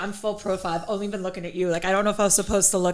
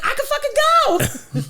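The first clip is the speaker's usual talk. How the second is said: eager excitement; much faster than usual. Retold or imitated. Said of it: "And I was like".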